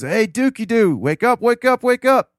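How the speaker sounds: chirpy wake-up call in grandpa's voice; grandpa waking him. Retold or imitated. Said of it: "So he's".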